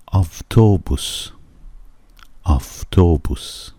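slow "آفتوبوس", second sound between v and f; Russian avtobus. Shown as a demonstration. Teacher repeating "آفتوبوس" for students to copy. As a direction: pronounce "آفتوبوس" slowly, the v almost like f.

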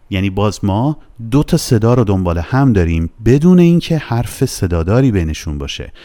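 ordinary delivery

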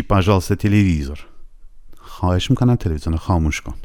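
normal talking voice

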